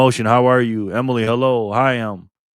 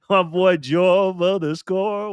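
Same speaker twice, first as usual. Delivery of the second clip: slow, stretched hype announcer call; 7 semitones higher, 4 dB louder, much slower than usual. Acted. Host welcomes a member like a sports announcer, drawn out. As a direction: boom it out like a ring announcer.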